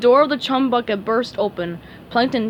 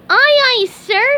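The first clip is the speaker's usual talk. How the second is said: very high, eager cartoon sponge voice; high squeaky sponge voice; SpongeBob voice; 9 dB louder, slower than usual. A narrator's reading. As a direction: chirp it like a keen little fry cook. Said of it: "SpongeBob answered".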